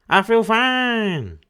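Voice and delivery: slow, high put-on corpse voice; dead man's voice. A narrator's reading. Dead man insisting to his widow, slow and high. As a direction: drag it out like a cheerful corpse.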